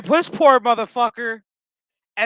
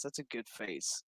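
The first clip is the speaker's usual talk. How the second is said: normal talking voice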